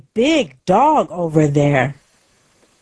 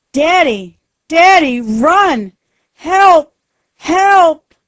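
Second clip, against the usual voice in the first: high, loud desperate shouting; panicked scream; 9 semitones higher, 6 dB louder than usual. Acted. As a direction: scream to warn Daddy and call for help. Susie screams as wolf attacks.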